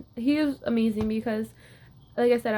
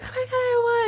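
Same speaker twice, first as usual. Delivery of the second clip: high, choked cry of joy; tearful joy; 14 semitones higher, 3 dB louder than usual. Retold or imitated. Reliving the moment she won nationals match, high tearful cry. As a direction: sob out the win, almost squeaking.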